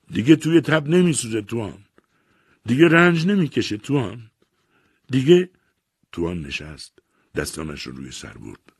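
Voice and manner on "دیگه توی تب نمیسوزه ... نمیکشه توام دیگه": high, loud cry of grief; anguished shout. A narrator's reading. Arsat crying out to Tuan that his wife has died, a loud high anguished shout. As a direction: shout it out to the dawn, much higher and louder. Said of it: "سپس فریاد زد".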